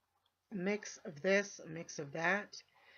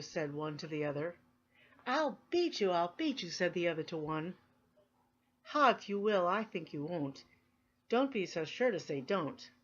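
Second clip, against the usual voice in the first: lively sing-song race between two boasting rivals; playful, boastful verse with swooping pitch; animated poem reading; 4 dB louder than usual. Acted. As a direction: read the rhyme with bouncy, punched openings.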